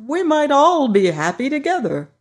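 Scarecrow voice. Acted. Scarecrow boasting to his friends, high and loud. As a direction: play the proud new ruler, brighter and up. Scarecrow hopes Dorothy stays.

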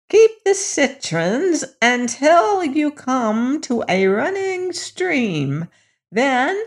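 put-on high old hag voice. A narrator's reading. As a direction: croak it like a kindly hag. Old hag instructs the prince.

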